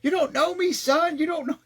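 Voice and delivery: retold scream from a runner alone on a hilltop; imitated screaming. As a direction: roar it to yourself on the climb. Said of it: "he kept screaming".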